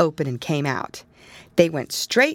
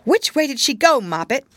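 urgent; 8 semitones higher, 5 dB louder than usual. A narrator's reading. Urgent question to kitten.

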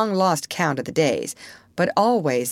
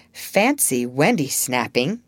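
plain reading voice

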